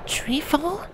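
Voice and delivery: uneasy question in the dusk; very high, hushed and wary; Tibia, hushed. Acted. Tibia the changeling voiced.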